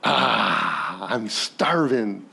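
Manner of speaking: low, slow, groaning famished voice; starving Esau. Acted. Esau to Jacob at the pot, slow famished groan. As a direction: drag it out like you're faint with hunger. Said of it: "And he's like".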